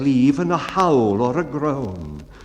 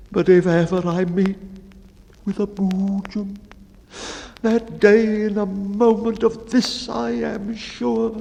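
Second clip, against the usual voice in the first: fearful baker; 12 semitones higher than usual. Acted. Baker confessing his dread of the boojum, high and fearful. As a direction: dwell on the horror of vanishing.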